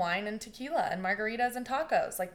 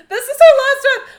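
much higher, much louder, near-shrieking panic with a laugh; frantic panic; 22 dB louder than usual. Retold or imitated. The family freaking out at her last breath, frantic and loud. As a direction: let the panic break into a half-laughing shriek.

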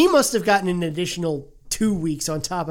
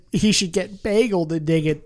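usual speaking voice

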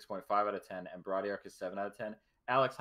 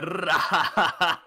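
rolled R laugh; 8 semitones higher, 12 dB louder than usual. Shown as a demonstration. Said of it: "more like a roll R".

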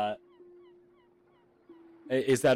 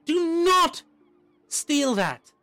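frazzled teacher voice; 4 dB louder than usual. Acted. Teacher scolds child mid-greeting.

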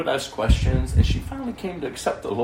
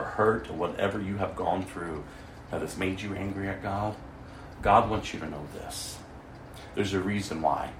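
lower, quieter earnest plea; solemn gentle warning; 5 semitones lower, 7 dB quieter than usual. Retold or imitated. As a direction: speak softly, as a caring minister warning her. Solemn word to the angry woman.

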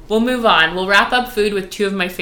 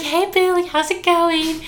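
sweet greeting; 10 semitones higher than usual. Retold or imitated. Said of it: "I was like".

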